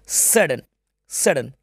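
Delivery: "सडन" said slowly with a drawn-out first "स"; pronunciation demo. Shown as a demonstration. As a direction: sound out "सडन" carefully, one "ड" sound. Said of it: "इस तरह से इसका, आ, transcription हो जाएगा". ("सडन" का धीमा, साफ़ उच्चारण)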